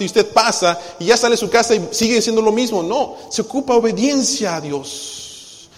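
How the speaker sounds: loud, high preacher's cry, slowing to drive it home; full-force preaching. Acted. Preaching on obedience. (predicación a todo pulmón, fuerte y aguda)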